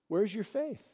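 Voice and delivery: soft, hushed "where's your faith"; gentle question. Acted. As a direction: ask "where's your faith" quietly and intimately. Jesus gently asks the congregation.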